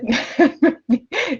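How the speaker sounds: excited laugh. Real, not acted. Excited about new printer.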